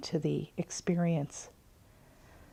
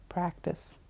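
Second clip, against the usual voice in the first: trailing off; 10 dB quieter than usual. Real, not acted. Repeated word, trailing off.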